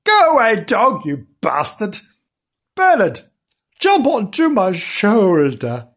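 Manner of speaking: loud, high shooing and calling; commanding high call. A narrator's reading. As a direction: shout off the dog, summon the kitten. Girl shoos dog, calls kitten.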